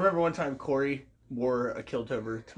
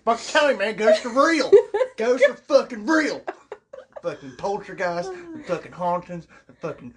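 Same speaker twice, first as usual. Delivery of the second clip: loud, high rowdy hillbilly hollering; 11 semitones higher, 8 dB louder than usual. Acted. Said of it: "it's gonna come out hillbilly".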